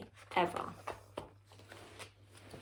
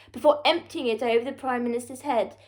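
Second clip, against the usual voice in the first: ordinary delivery, nothing marked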